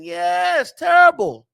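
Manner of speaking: high, loud blast of disgust. Real, not acted. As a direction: shout the verdict. Ranting about bad defending.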